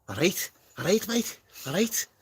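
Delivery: high, exaggerated Scouse greeting; Scouse accent. Shown as a demonstration. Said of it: "that was my Scouse".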